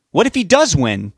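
high, loud, angry challenge; yelling back. A narrator's reading. Richard argues back at his wife, yelling. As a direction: yell the question back at her. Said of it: "Richard yelled back".

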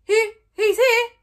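loud high slow shout; yelled. Acted. Fishlegs yelling to the chief over the crowd. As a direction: yell out to the chief. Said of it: "yelled Fishlegs".

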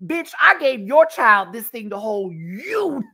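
high indignant ranting; mock angry mom. Mom and clerk arguing, high angry retort. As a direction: rant back high and offended. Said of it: "The mom's like".

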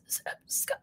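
stammering, drawn-out whisper of a dying man. Retold or imitated. Prime minister dying, faint stammering whisper. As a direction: gasp out the name with your last breath. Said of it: "And then he says".

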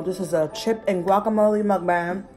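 ordinary talk

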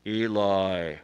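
very loud, low, drawn-out call; mysterious calling voice. Acted. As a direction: boom the name out like a voice in the night. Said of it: "and he hears".

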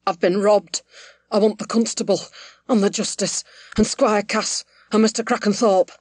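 agitated, gasped-out plea; short of breath, push out each phrase in bursts; gasping. A narrator's reading.

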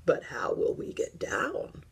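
put-on old teddy bear asking, puzzled. A narrator's reading. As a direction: ask as an old bear would. Old Bear's reply, timbre shifted.

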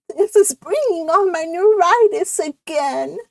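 high, put-upon character voice; ailing cousin's complaint; complaining cousin. Acted. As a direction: moan about it in a high-pitched voice. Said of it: "this is a different voice".

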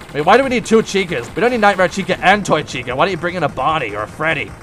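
normal talking voice

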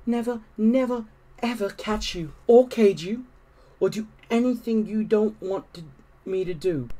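high character voice, pleading; earnest promise. A narrator's reading. Susan vowing to Harriet, high and earnest. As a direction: vow it in a high girl's voice. Girl promising the cat in story.

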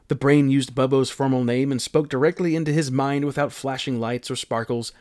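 usual speaking voice

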